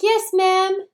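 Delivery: very high, loud put-on servant voice; mock obedient maid. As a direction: answer the mistress in an exaggerated high pitch. Imagined maid answering her employer.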